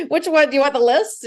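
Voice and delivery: joking retort through laughter, high and loud; laughing. Retold or imitated. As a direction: laugh out the comeback. Jokes about her many surgeries.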